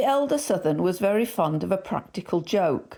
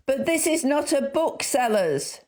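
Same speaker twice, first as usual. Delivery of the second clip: shouted; 8 semitones higher than usual. A narrator's reading. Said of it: "shouted the assistant".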